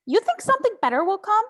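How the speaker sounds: loud, high and fast scolding; angry outburst. Acted. Mother berating her daughter about the protests, angry shout.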